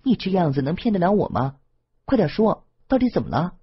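don't let her fool you, push harder; worried pressing. A narrator's reading. Lan Feng pressing Wang Tong for the truth, high worried insistence. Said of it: "更加担心".

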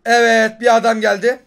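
loud, high burst spotting an enemy; excited shout. Real, not acted. Gamer shouting to viewers as an enemy shows up, excited. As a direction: yell it out as the enemy appears. Spots enemy mid-fight.

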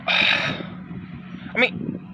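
breathy sigh into a whispered aside; sigh, then say it under your breath. Acted.

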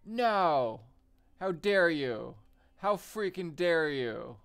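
furious mock outrage shout; shaking with anger. Real, not acted. Opponent sends family to police.

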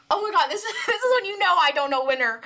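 laughing. Real, not acted. Surprised the singer raps.

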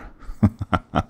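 short run of amused laughter; chuckle, pleasantly caught off guard; light villain laugh; surprised chuckle. Acted. Villain surprised by hero's new line.

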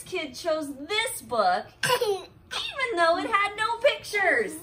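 loud, giggling read; can barely read for laughing; laughing through words. Real, not acted. Mom reading to Harper, laughing through the compliment.

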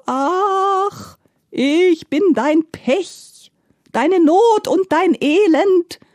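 high, drawn-out, loud wailing; sobbing lament. A narrator's reading. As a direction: wail your misery slowly and high. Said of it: "schluchzte die Gestalt".

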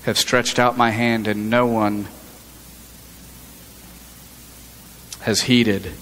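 low, grave warning as Lady Wisdom; stern Lady Wisdom. Acted. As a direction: let Wisdom's rebuke sound heavy and low. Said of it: "Lady Wisdom goes on to say".

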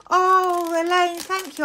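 oh, a real burst of delighted thanks; delighted gasp. Real, not acted. Opening Elaine's surprise gift, delighted. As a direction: gasp with joy at a friend's gift.

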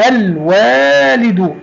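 الوالد very drawn out, l clearly held; slow classroom model of the l in الوالد; pronounced lam. Shown as a demonstration. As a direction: say الوالد as alll-waalidu. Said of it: "نطقناها وكتبناها".

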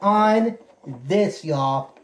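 slow, drawn-out showman reveal. Pretend. Unboxing gifts for viewers, slow showman reveal. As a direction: drag it out like a ringmaster unveiling a prize. Showing off a gift.

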